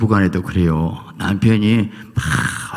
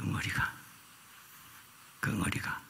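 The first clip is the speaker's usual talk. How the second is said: fading whisper; 18 dB quieter than usual. Shown as a demonstration. Preacher repeating 응어리가 to the congregation, fading into a whisper.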